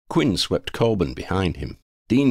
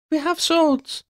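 reminding; slower than usual. Acted. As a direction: pipe up with a bright reminder. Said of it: "Sophie reminded them".

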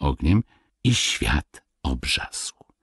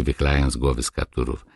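plain reading voice

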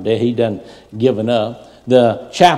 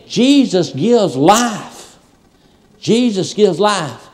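slow declaration; 8 semitones higher, 3 dB louder, much slower than usual. Acted. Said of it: "slow emphatic declaration".

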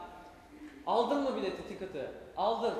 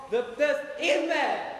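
kapı sesi taklidi; 4 semitones higher, 5 dB louder, much slower than usual. Acted. Otobüs kapısı sesi ve uyarı.

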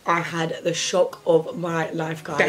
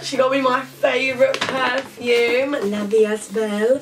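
delighted gushing; 6 semitones higher, slower than usual. Real, not acted. Delighted by a gift.

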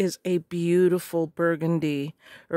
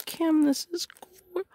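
excited; 10 semitones higher than usual. Real, not acted. Finding another item.

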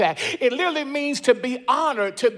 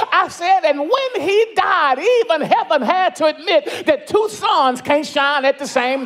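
high, loud whooping preacher climax; preaching at full force; 5 semitones higher, 4 dB louder than usual. Acted. Preacher tells the caller about Jesus, full preaching. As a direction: lift the church higher with every line.

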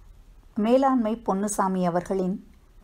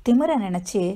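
ordinary delivery, nothing marked

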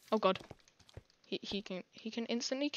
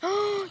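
loud, high gasp; short, high, thrilled intake of breath; excited gasp; 10 semitones higher, 11 dB louder than usual. Real, not acted. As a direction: gasp with sudden delight. Effect finally works in game.

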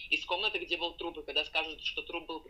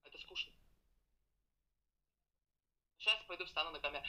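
ordinary talk, nothing marked

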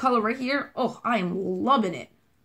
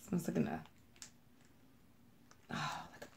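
whisper; 6 semitones lower, 19 dB quieter than usual. Real, not acted. Sniffing a wax melt.

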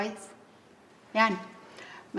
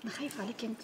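tender, softer; 13 dB quieter, faster than usual. Acted. Tender reply to her friend.

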